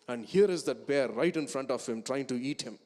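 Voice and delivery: ordinary delivery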